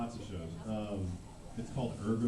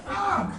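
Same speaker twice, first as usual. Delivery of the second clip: high short mock yelp; a bit-part death cry; mock scream; 6 dB louder than usual.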